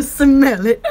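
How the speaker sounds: "smell it" broken up by laughter; can't keep the ad voice straight for laughing. Pretend. Giggling at her own sniffing commercial, laughing through it. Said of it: "scratch and sniff commercials".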